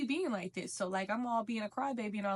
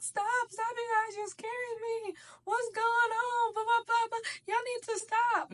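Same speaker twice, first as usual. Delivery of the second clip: panicked scream; 12 semitones higher than usual. Retold or imitated. Girl in the back begging the fighters to stop, panicked high scream. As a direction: screech at them to stop, hammed-up. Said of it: "she just crying and screaming".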